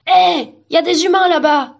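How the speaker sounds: tree crying out; alarmed shout. A narrator's reading. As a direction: shout a warning to the others. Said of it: "s'écria un arbre".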